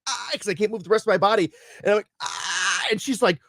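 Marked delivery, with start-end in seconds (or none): 0.1-0.3 stifled screeching "aah"; strained screech. Retold or imitated. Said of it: "almost barely audible screeching sound". 2.2-2.9 high, stifled "aah"; strained screech. Retold or imitated. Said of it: "almost barely audible screeching sound".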